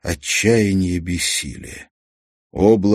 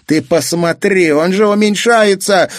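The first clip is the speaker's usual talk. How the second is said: put-on high, loud boyish alarm; alarmed cry; 12 semitones higher, 7 dB louder than usual. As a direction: point up in fright. Kazik alarmed. (испуганный крик мальчика)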